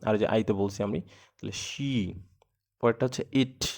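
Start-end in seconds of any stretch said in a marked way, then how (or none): 1.0-2.2 "she" very slow and soft, a long sh. Shown as a demonstration. Teacher shows students a slow soft "she". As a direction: ease out "she" slowly. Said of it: "এটা হচ্ছে স sound".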